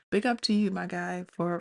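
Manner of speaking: ordinary talk, nothing marked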